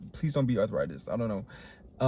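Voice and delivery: ordinary talk, nothing marked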